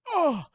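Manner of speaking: loud, high "oh"; imitated pained cry. Playing Barbosa feeling his wound, mock pained cry. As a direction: cry out as if just shot.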